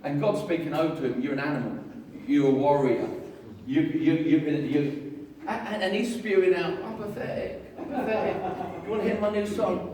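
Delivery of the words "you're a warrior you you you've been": ordinary talk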